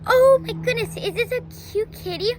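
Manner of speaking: high, loud squeal of delight; fast excited cooing; delighted girl. Retold or imitated. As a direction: gush over a cute kitten.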